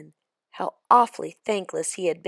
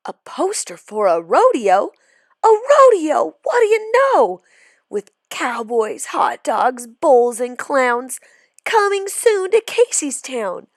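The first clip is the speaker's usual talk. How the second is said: higher and louder, bursting with excitement; thrilled young cowboy; excited kid; 6 semitones higher, 9 dB louder than usual. Acted. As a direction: shout it out like you just found treasure. Kid discovers rodeo poster.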